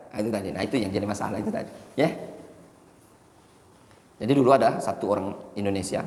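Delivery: normal talking voice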